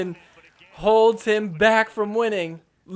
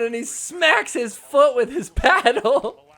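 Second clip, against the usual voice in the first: laughing; 7 semitones higher, 5 dB louder, slower than usual. Real, not acted. Laughs while describing foot smack.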